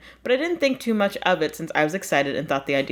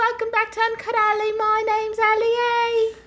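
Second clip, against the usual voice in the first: a silly high hidden-under-a-blanket voice. Pretend. Opening the show with a silly high voice. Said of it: "Why are you doing a voice?".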